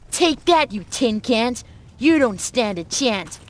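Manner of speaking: loud, high playful taunt at imaginary foes; hammed-up battle taunts; pretend fighting shout. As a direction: yell it like a kid playing soldiers. Child pretends to fight machines.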